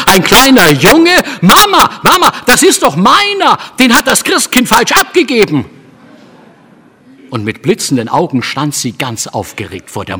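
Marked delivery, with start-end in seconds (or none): 0.1-5.6 put-on shrill, much higher, loud excited child; little girl crying out to her mother, high and thrilled. A narrator's reading. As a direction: shriek with joy, a little girl who has found her prize. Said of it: "stieß einen Schrei aus".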